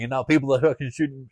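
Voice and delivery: ordinary delivery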